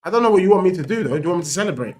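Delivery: ordinary delivery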